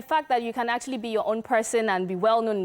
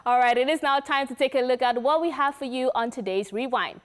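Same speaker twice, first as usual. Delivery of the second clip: higher, upbeat host greeting; radio host welcome; 5 semitones higher than usual. Acted. Cohost welcoming listeners back, lively radio voice. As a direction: pump up the listeners.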